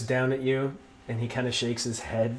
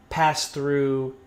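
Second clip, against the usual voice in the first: usual speaking voice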